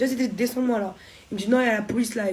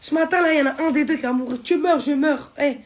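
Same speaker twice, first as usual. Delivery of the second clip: loud, high threatening shout; retold shouted death threat; furious threat; 6 semitones higher, 7 dB louder than usual. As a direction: yell the threat like you mean it. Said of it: "je commence à devenir hystérique".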